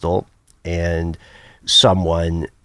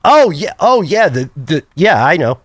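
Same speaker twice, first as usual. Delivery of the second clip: loud, high, offhand agreement; retold wife; 6 semitones higher, 6 dB louder than usual. Wife admitting she knew all along, imitated high and emphatic. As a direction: imitate a breezy admission, starting high and loud. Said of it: "And she goes".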